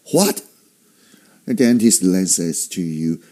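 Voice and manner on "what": high, incredulous question; can't believe your ears; disbelieving. Acted. Patient told of three cavities, disbelieving. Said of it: "like when you can't believe your ears".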